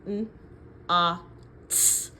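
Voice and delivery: "N, A, T" sounded one by one; tapping out sounds. Shown as a demonstration. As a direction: sound out "N, A, T" slowly.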